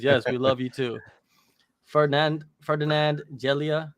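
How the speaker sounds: ordinary delivery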